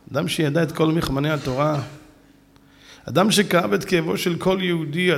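ordinary delivery

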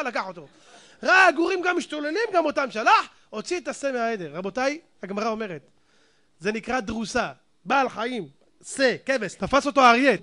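ordinary talk

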